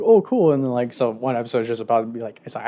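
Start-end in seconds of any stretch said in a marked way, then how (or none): 0.0-0.5 delighted. Retold or imitated. Hero's delighted reaction.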